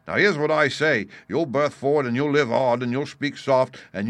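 loud rebuke from a pirate boss; commanding pirate leader. A narrator's reading. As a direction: lay down the law, loudly. Silver rebukes and orders coxswain.

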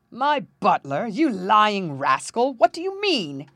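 loud, incredulous outburst rising high; outraged king. A narrator's reading. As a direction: thunder at an insolent servant, dragging out the insult. King enraged at prince's impudence.

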